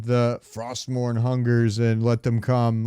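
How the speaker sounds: ordinary talk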